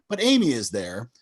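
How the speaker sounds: ordinary talk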